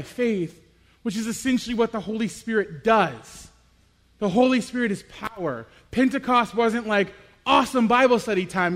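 loud, fervent sermon delivery; full-force preaching. Acted. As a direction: drive the story home to the congregation.

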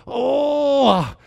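high drawn-out "oh", falling away; mock groan. Husband imagining his wife's first contractions, mock groan. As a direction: act out a mild contraction.

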